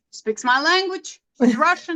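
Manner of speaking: high, playful exclamation; a mock-thrilled realization; telling the host how the doodling won her over, high and playful; delighted joke. Joking about finding a kindred spirit.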